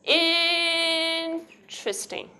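"Interesting" with a long held IIIN, then "tristing"; one syllable left out. Shown as a demonstration. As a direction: stretch the start of "Interesting", drop a syllable. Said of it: "you leave out one syllable".

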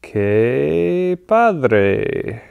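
"Qué padre" said the Spanish way, rising then falling; Spanish pronunciation. Shown as a demonstration. Teacher giving students a Spanish exclamation, clean native sound. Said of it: "you can say something like".

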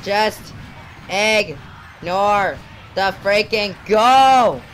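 slow, loud, broken-up shouting; desperate yell. Real, not acted. Player in the last seconds of losing, desperate yell. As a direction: force out each word, then wail.

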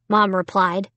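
ordinary delivery, nothing marked

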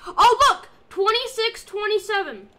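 ordinary delivery

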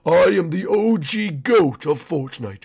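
ordinary talk

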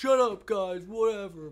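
high, loud mock outburst; Johnny's mock annoyance. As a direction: yell it like a teased friend fed up. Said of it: "we would make fun of him".

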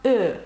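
Е said as rounded French "eu"; French letter name E. Shown as a demonstration. Teacher pointing out the French letter, rounded "eu". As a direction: round the lips on Е, "eu". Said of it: "у французькій мові".

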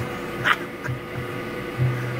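wheezing unvoiced laugh; a quiet, airy burst of laughter; breathy silent laugh. Real, not acted. Laughs at his own joke.

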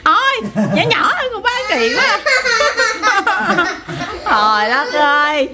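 high-pitched words through real laughter; laughing protest. Real, not acted. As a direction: protest while cracking up. Cake smearing, everyone laughing.

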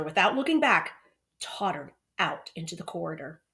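ordinary delivery, nothing marked

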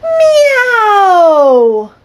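high, loud, long meow; loud cat meow. Acted. The cats answer the narrator, loud high meow. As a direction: belt out a big cat meow.

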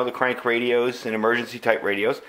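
normal talking voice

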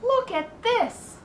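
put-on high, slow boy's cry of discovery; excited cry. A narrator's reading. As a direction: shout it out, amazed. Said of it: "cried Avery".

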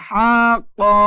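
হাক্কা loud, with হা lengthened before the doubled ক; stretched first vowel. Shown as a demonstration. Teacher showing which sound stretches, হাক্কা with long হা. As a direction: lengthen হা, then close firmly on ক in হাক্কা. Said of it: "এইগুলাতে টান হবে না".

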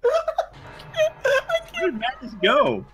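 slow, high, cracking with laughter; howling the question through laughs; laughing wail. Real, not acted. Player cries out laughing at the betrayal.

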